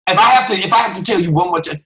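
fast, high, loud threat; hammed-up fed-up parent; scolding parent. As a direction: rattle off a warning to a child. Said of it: "talking to kids like that".

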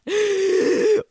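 high loud mock gasp; theatrical high gasp; shocked gasp. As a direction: gasp in fake horror.

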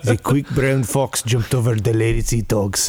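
measured attempt at a German accent. Shown as a demonstration. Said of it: "your first accent is German".

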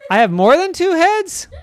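high, loud disbelief with a laugh; alarmed at the idea; shocked kid. Acted. Brother panicking, laughing.

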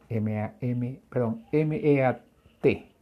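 ordinary talk, nothing marked

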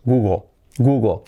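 "Google" ending in an O sound, like "gugo"; O-like final L. Shown as a demonstration. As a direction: swallow the L of "Google" into an O. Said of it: "hace ese efecto de O".

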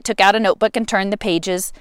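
ordinary delivery

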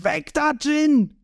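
high, urgent yell; urgent high holler; shouted warning. A narrator's reading. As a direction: shout to get them out of the way. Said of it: "Sanji schreit".

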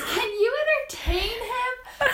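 high, loud call down the stairs; yelling. Retold or imitated. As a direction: shout it up from another floor. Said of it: "yells down from upstairs".

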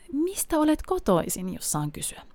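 a Dane politely wondering, imitated; mock polite question.

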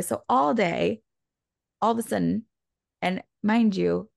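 normal talking voice

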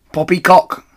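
loud, high "Poppycock"; scoffing. Acted. Rat scoffs at Mister Fox, loud and high. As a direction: scoff it away. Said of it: "said Rat".

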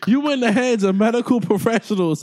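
high, loud, laughing rant; hammed-up bratty friend. The friend ditching her fainted pal, loud laughing rant. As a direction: play it big and loud, half laughing.

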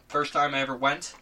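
ordinary delivery

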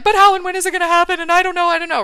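high, loud, rushed fretting; mock panic. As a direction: act out a frantic spiral of doubt.

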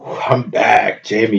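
ordinary talk, nothing marked

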